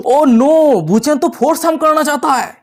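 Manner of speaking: high-pitched, scandalised outcry; shocked. A narrator's reading. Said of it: "जो और भी ज्यादा शॉक हो जाती है". (चौंककर, ऊँची आवाज़ में)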